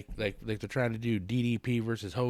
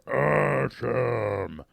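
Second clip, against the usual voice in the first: slow, louder, stretched-out mock catchphrase; lazy Miz catchphrase; 4 semitones higher, 7 dB louder, much slower than usual. Host mocks wrestlers taking the month off, slow drawn catchphrase. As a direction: drag the word out lazily. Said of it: "just hanging out going".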